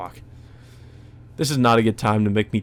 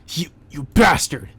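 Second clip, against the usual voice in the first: angry outburst; 4 semitones higher than usual. Acted. Hero yelling at Shiki after his glasses are knocked off, furious. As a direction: spit the insult out in rage.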